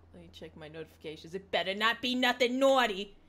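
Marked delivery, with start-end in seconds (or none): 1.3-3.2 loud, playful threat; mock warning. Streamer warning chat before checking notifications, loud mock threat. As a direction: warn the chat half-jokingly.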